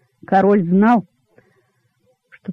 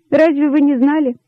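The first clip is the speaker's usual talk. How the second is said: higher, ruefully plaintive question; contrite; 6 semitones higher than usual. A narrator's reading. Rabbits confessing to the king, contrite. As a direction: ask it ruefully, pitch lifted. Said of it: "сокрушённо отвечали кролики".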